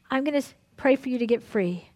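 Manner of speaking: ordinary talk, nothing marked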